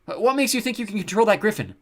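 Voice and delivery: high, loud challenge; stammer, then call out in alarm; alarmed shouting. A narrator's reading. Jared still shouting after Simon.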